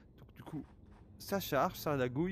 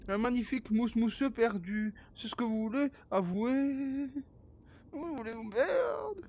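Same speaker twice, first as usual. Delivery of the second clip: voix de fausset moqueuse; 10 semitones higher, 3 dB louder than usual. Pretend.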